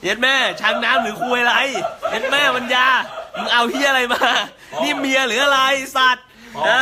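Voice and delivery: high, loud jeering through laughter; laughing mockery. Real, not acted. Mocking rival while laughing. (หัวเราะเยาะเสียงสูงดัง)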